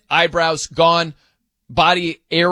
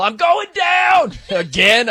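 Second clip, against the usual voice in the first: high, drawn-out comic holler; mock falling yell; 13 semitones higher, 4 dB louder, slower than usual. Host imitating a listener falling down an escalator, mock yell. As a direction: yell it out long and high like someone toppling. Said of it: "had to yell".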